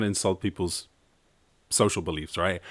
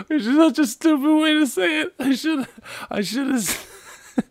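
much higher, giggling self-mockery; laughing at himself; 5 dB louder than usual. Real, not acted. Mocking his own stupid question while laughing. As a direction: laugh through the words.

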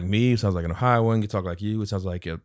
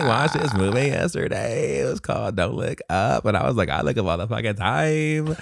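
put-on valley girl. Shown as a demonstration. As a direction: keep up a hammed valley girl act. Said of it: "You can be like a valley girl".

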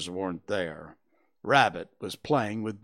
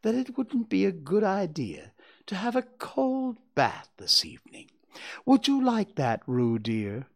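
higher-pitched motherly musing; thoughtful; 9 semitones higher than usual. A narrator's reading. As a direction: float the idea innocently to a child. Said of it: "in a thoughtful voice".

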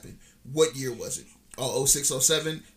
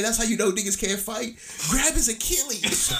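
a hint of a hyped stand-up bit, pitched up; Kevin Hart impression; 8 semitones higher than usual. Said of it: "what did Kevin Hart say?".

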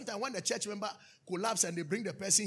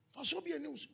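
usual speaking voice

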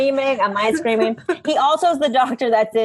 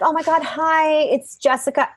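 higher, bubbly retold hello; excited greeting; 6 semitones higher than usual. Former patient greeting her vocal doctor, excited. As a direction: gush a little, re-enacting the greeting.

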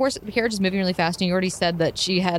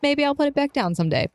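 musing, pitched higher; thoughtful; 7 semitones higher than usual. Acted. Snow answers her companion about her hair, thoughtful. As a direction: say it as a pondering maybe. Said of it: "looks thoughtful, and she says".

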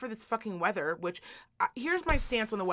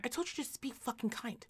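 soft scolding God; 2 semitones higher, 12 dB quieter than usual. Pretend. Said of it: "He's like".

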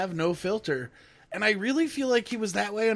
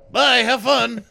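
loud, higher send-off; loud brush-off; 5 semitones higher, 12 dB louder, slower than usual. Retold or imitated. Dad dismissing the nervous suitor, loud brush-off. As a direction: call out a curt goodbye loudly.

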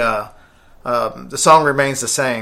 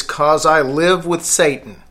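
slow, deliberate; slower than usual. Shown as a demonstration. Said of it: "what they say that it says is".

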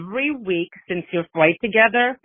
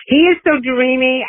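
gushing; 5 semitones higher, 6 dB louder than usual. Real, not acted. Gushing over a crush.

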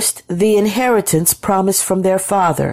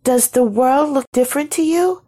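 high, uneasy question; mildly frightened, pitched well up; trembling a little; 9 semitones higher than usual. A narrator's reading. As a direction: ask nervously in a high voice. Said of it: "trembling a little".

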